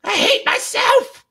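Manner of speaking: high, slow whiny wail mocking a tantrum; hammed-up crybaby tantrum voice; mock temper tantrum. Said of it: "you do a little temper tantrum".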